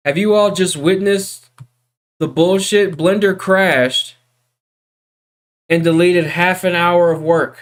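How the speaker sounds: loud, high-pitched outburst of disbelief; venting loudly at the crash; outraged. Real, not acted. Blender crashed, lost his work.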